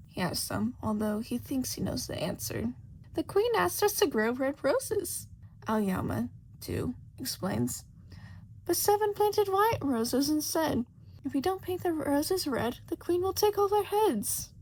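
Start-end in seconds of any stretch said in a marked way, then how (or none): 3.1-5.3 high, eager playing-card gardener. Storyteller voicing the gardener card to Shota, high and worried. 8.7-14.5 high, eager playing-card gardener. Storyteller voicing the gardener card to Shota, high and worried.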